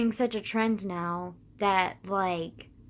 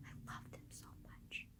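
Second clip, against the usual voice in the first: whispered, much softer adoration; breathy whisper; 25 dB quieter than usual. Real, not acted. Fan confessing love for drag performers, whispered.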